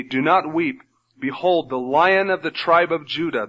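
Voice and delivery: normal talking voice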